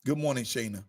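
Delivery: usual speaking voice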